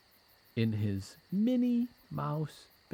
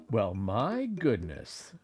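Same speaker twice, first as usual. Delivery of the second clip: plain reading voice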